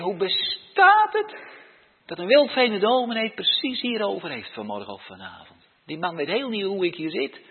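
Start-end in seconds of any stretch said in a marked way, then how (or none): 0.0-1.4 much higher, slow wonder; amazed outcry. Retold or imitated. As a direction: marvel aloud at the coincidence. Voices amazed listener. (verbaasd, hoog uitroepen)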